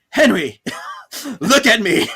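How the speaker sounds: loud, high mock demand; hammed-up wife pleading with Henry; mock confrontation. [name] confronting Henry, loud and high.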